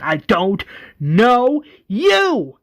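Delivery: loud, slow, high yell; acted screamed denial; drawn-out scream. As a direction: stretch each word into a frantic shout. Morgan attacks Rick, yelling.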